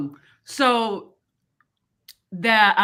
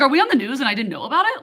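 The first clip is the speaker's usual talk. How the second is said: mock alarm; 6 semitones higher, 6 dB louder, much faster than usual. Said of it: "I was like".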